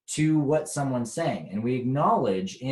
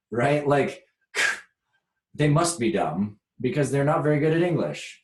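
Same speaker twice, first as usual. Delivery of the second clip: ordinary talk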